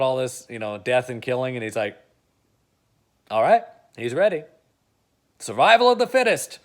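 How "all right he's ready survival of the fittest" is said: higher, louder cold villain line; lightly voiced ruthless villain, rising to a loud peak; Bryan Cranston selling it. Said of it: "I bet Bryan Cranston could sell it".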